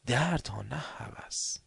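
breathy whispered aside. Acted. Preacher whispering the point to listeners. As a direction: drop to a whisper.

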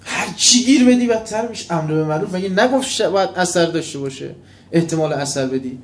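loud, high, fervent preaching; preaching at full force. Acted. Preacher rebuking his congregation, loud and fervent. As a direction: hammer the point home to the crowd.

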